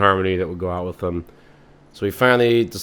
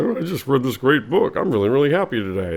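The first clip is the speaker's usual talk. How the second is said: higher, chirpy nerd voice; happy nerd; 5 semitones higher, 4 dB louder than usual. Acted. Nerdy man leaving the library, cheerful higher voice. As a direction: beam about a good book. Nerdy guy after reading.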